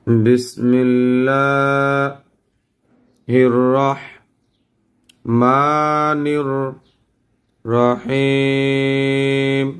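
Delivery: long drawn-out chant; slow Quranic recitation. Shown as a demonstration. Teacher models recitation for students, slow chant. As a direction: recite it slowly for them to repeat. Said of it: "সবাই আমার মতন পড়েন।".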